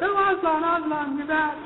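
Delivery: put-on high British child accent, delighted; little British girls. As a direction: squeal it like young British sisters overjoyed to see Aslan. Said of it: "their little British accents".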